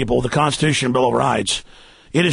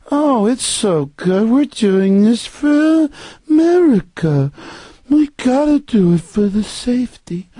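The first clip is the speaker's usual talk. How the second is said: high, drawn-out mock-nice public voice; Philip Bobbitt; 6 semitones higher, slower than usual. Host mocking officials addressing an event, sugary fake-nice voice. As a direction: gush slowly with syrupy, fake kindness. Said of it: "They all talk like this when they're at events".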